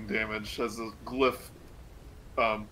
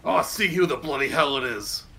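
gruff cultist voice, a bit louder; cultist behind the door; 3 semitones higher, 6 dB louder than usual. Acted. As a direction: growl it like an annoyed guard behind the door. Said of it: "you hear a voice go".

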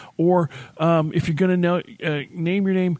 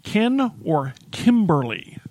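slow, higher, spelled-out choice; distinct names; 4 semitones higher, slower than usual. Retold or imitated. Receptionist sorting out similar names on the phone, distinct and slow. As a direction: separate the two names slowly and clearly. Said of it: "got to the point where it was".

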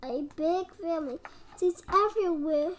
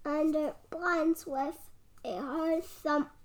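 ordinary delivery

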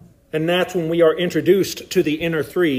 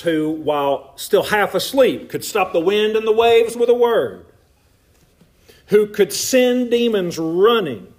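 louder, higher, rising preaching run; building preaching; 5 semitones higher, 4 dB louder than usual. Acted. Preacher listing Jesus' miracles to the crowd, louder building preaching.

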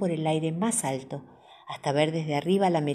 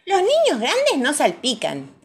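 put-on high, louder child's voice; high-pitched know-it-all little girl; little girl Pepa; 9 semitones higher, 5 dB louder than usual. A narrator's reading. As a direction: state it like a proud big kid.

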